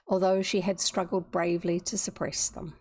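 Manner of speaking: ordinary delivery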